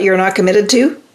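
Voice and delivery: usual speaking voice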